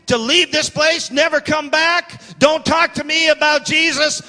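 high, slow, angry warning; unglued. Retold or imitated. Local authority warning off the pastor, coming unglued. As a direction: lay down each warning hard and high. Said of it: "uh, he came unglued".

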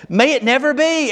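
"May it never be" pitched high throughout, as an exclamation; emphatic exclamation. Acted. Preacher reading Paul's answer in verse thirteen, emphatic. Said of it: "Exclamation point".

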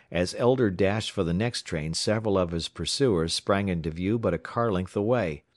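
ordinary delivery, nothing marked

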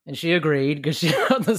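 laughing through the words, pitch rising. Real, not acted. Dad jokes to a fellow dad about dreaded bedtime, laughing.

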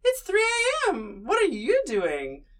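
high, slow, sing-song fake sweetness; playing innocent to a late-night caller; mock surprised call. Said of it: "and then I was like".

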